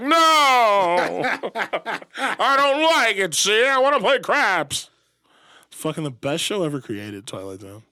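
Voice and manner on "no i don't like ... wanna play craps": high, loud, drawn-out protest; over-the-top horrified outburst; anguished mock wail. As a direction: wail it out like a hammy doomed gambler. Acting gambler's horrified outburst.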